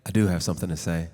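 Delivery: very low, booming put-on voice; mock deep voice. Performer answering the host in a hammed-up deep voice. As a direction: answer in a cartoonishly deep rumble. Comic skit reply to host.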